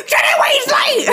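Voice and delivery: very high, loud mock shout; hammed-up roll-call screech; same frantic energy. Dumbledore summoning another student, high mock shout. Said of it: "He used the same energy too".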